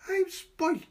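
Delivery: put-on high, loud child's shout. A narrator's reading. Boy shouting gleefully across the park. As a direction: crow it out like a happy boy. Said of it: "he shouted".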